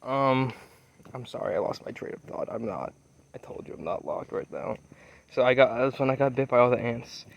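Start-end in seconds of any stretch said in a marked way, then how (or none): 1.1-4.8 quick hushed aside, off to someone nearby; whispered apology, barely voiced; real whisper. Real, not acted. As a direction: drop to a whisper mid-story.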